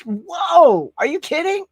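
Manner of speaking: high, loud burst of amazement; amazed outburst. Real, not acted. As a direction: exclaim it high in astonishment. Reacts to the viewer's movie count.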